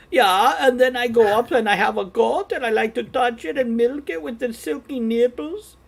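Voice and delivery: high, sing-song German caricature; comic German accent. Shown as a demonstration. Streamer showing co-hosts her Brewfest character, high German accent. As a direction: babble eagerly in a high-pitched German accent. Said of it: "That kind of German accent".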